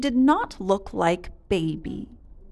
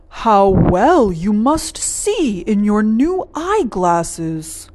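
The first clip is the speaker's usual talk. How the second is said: slow, louder fussing cluck; a lightly voiced father duck praising the glasses; 5 dB louder, slower than usual. A narrator's reading. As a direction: cluck it like a fussy duck dad. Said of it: "clucked Mister Duck".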